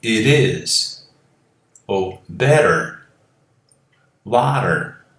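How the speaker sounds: American accent demo; American flap T. Shown as a demonstration. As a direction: tap the T in "better", "water". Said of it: "Assim como eu digo em inglês americano".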